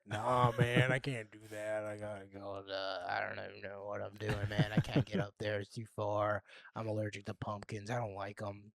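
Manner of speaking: low, soft mock Markham accent. Local begs off the fair, low and soft in mock Markham accent. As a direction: make excuses in a low put-on Markham voice. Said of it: "Oh, Markham accent".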